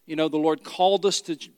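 normal talking voice

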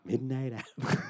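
soft, very slow horror narrator; hushed sinister drawl; Vincent Price. Acted. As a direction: intone it spookily, stretching the words. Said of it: "your amazing Vincent Price".

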